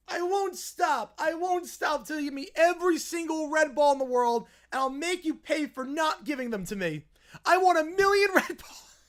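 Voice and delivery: high, loud tantrum shriek; screaming bratty kid. Acted. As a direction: scream it like a spoiled child who won't share. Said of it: "The two kids turn on Tom".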